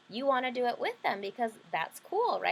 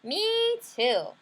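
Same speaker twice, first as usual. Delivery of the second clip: put-on much higher, louder little girl voice; little sister voice; 11 semitones higher, 5 dB louder than usual. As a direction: squeal it like an eager small sister. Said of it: "My little sister said".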